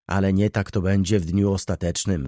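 usual speaking voice

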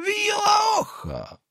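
very high, drawn-out cry to horses; driver's call. Acted. Peasant urging his nags on, calling out. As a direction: holler it high to get the horses moving.

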